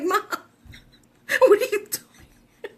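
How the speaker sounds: high, slow quote broken by laughter; laughing quote. Retold or imitated. Mom quotes her daughter while laughing. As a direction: giggle out the daughter's words. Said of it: "she's like".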